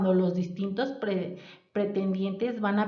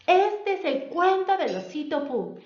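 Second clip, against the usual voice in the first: put-on higher, bright storytelling announcement to children; storyteller opening; 7 semitones higher, 4 dB louder than usual. Shown as a demonstration. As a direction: open the tale like a kindergarten storyteller. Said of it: "de cómo estás contando tu cuento". (voz de cuentacuentos para niños)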